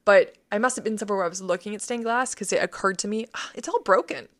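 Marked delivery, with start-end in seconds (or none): 3.3-4.3 high, exclaimed dismay; acted-out alarm at a discovery; retold dismay. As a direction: cry out as the shock builds, higher and louder. Retold sudden realization while praying.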